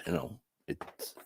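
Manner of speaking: breathless, barely voiced words; words fading to a whisper. Real, not acted. Breaking down mid-sentence.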